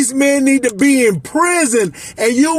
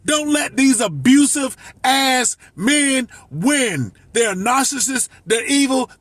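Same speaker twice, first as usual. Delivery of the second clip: ordinary talk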